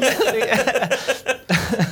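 high burst of laughter; laughing. Real, not acted. Laughing at dark joke.

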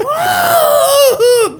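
high mock yell through laughter; laughing half-scream of a scared man; retold scream. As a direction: imitate him wailing in the room.

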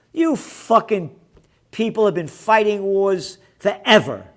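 angry shouted rant; furious accusation. Real, not acted. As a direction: berate them at full voice. Berating NATO over wars.

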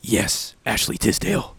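low, soft suave reply; smooth low voice. Acted. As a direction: answer her coolly and softly. Agent J's smooth reply.

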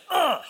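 high, mock-disgusted grunt; disgusted groan. Preacher imitating people once rules get applied, mock disgusted groan. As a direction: let out an exaggerated ugh.

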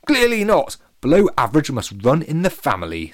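loud, high mocking sneer. A narrator's reading. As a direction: jeer it at him with contempt. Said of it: "sneered Dini".

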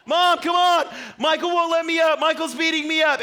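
put-on high, loud desperate kid's cry; calling for mom. Pinned little brother calling his mom, high desperate yell. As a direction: yell for mom like a trapped kid.

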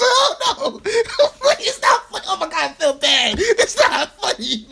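very high, loud, squealing through laughs; real laughing fit breaking up the words; helpless laughter. Real, not acted. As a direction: protest while cracking up, almost crying with laughter. Cracks up while insisting it's not funny.

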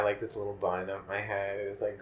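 ordinary delivery

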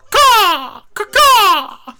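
shrill, very loud crow caw; crow call. Pretend. Hammed-up crow call summoning Al into the room.

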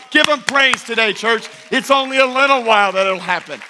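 rally the congregation to praise; rising preaching climax. Acted.

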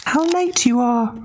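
very high teasing pig; cheeky little pig. Acted. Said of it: "he called out".

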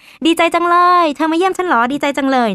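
high-pitched, imagined glee at a visit; delighted welcome. Retold or imitated. Uncle greeting his visitors, delighted. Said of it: "เหมือนอารมณ์ประมาณว่า".

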